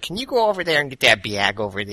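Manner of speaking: put-on thick Chicago accent. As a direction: talk like a relative from Chicago. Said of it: "sound like my, uh, my relatives from Chicago".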